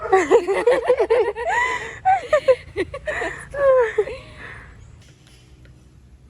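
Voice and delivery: loud shrieking laughter; long laughing fit. Real, not acted. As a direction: laugh until you can't stop.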